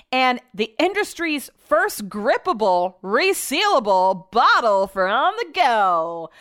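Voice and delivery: swooping, singsong pitch on each selling point; hammed-up ad read. Host mockingly reading energy drink selling points, hammed-up ad voice. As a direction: oversell it slowly like a commercial.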